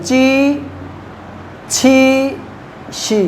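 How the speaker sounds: ची, छी, शी each said crisply and apart; ची छी शी as a teacher's drill; Chinese syllables demo. Shown as a demonstration. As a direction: sound out ची, छी, शी slowly for the class. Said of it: "आई लगाने से मेरी sound बन रही है".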